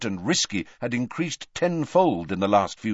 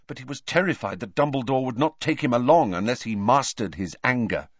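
ordinary delivery

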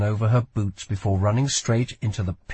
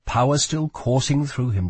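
ordinary delivery, nothing marked

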